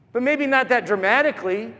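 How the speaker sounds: usual speaking voice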